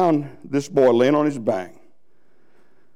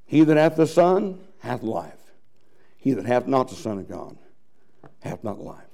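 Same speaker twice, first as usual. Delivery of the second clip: solemn scripture reading; 4 dB quieter than usual. Acted. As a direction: read the verse gravely, letting it fall away.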